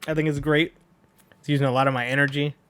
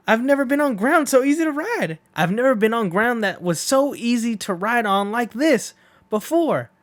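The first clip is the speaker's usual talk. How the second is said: high, loud whoops of delight; thrilled rider; 9 semitones higher, 4 dB louder than usual. Acted. Poco Loco riding easy ground mid-race, high and overjoyed. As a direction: cheer like the luckiest man alive.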